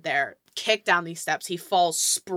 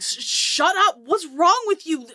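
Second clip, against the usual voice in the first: high, loud exasperated snap; angry guard; 10 semitones higher, 6 dB louder than usual. Acted. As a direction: scold him loudly as you grab him. Guards grabbing the screaming man.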